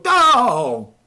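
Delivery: high, loud drawn-out "oh"; loud cartoonish cry. Shown as a demonstration. Voice actor replaying his demo tape sound, loud cartoonish cry. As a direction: belt out a high, exaggerated cry. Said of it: "they heard me go".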